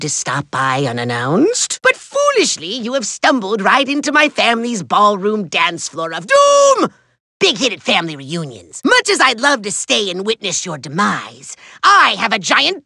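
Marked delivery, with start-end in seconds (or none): 6.3-6.9 high, stretched villain exclamation; melodramatic cry. Acted. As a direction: ring the word out for dramatic doom. Villain announces the trap.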